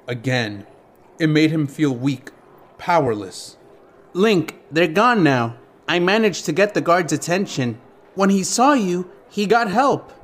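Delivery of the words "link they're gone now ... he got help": higher, lightly anxious explanation; anxious fairy helper. A narrator's reading. Navi explains to Link after the thieves flee, anxious. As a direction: reassure him in a higher fairy voice. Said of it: "Navi's words came in a hurried rush".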